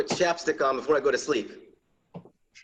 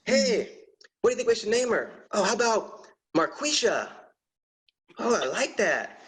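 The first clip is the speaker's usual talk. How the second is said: delighted parent; 7 semitones higher than usual. Retold or imitated. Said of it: "they were like".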